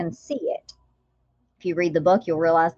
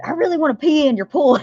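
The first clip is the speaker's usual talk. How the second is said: higher, louder Southern friend; joking friend asking about the pool, voiced higher and louder; 8 semitones higher, 8 dB louder than usual. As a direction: play the cheeky Southern friend, loud and high. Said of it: "and she said".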